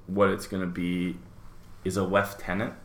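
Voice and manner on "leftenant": lieutenant said as "lef-TEN-ant"; British "leftenant". Retold or imitated. Cohost rating his friend a rank, British "leftenant". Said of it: "the old Brits say lieutenant".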